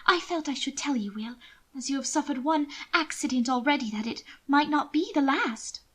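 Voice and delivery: a worried sister pleading. Acted. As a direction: press the danger on him. Mina confiding her fears.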